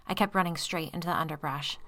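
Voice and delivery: usual speaking voice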